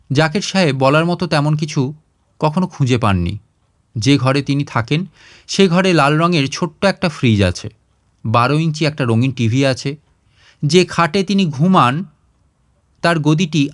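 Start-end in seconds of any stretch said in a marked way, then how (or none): none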